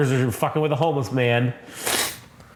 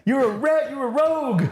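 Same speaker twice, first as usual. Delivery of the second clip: mock chief; 11 semitones higher than usual. Chief voice continues.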